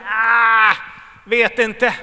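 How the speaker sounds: a loud, throwaway "ah, don't know"; dismissive shrug. Retold or imitated. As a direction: shrug it off loudly like a doubter. (högt, avfärdande axelryckning)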